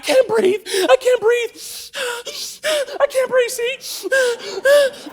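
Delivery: mock-hysterical whimpering panic; panicked gasping. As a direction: wail that you can't breathe. Pepper-sprayed recruit panicking.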